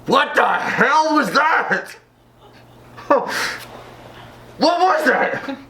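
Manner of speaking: high, slow, stretched what the hell; startled. Real, not acted. Reacting to a strange noise, high startled disbelief. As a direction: caught off guard by a weird noise.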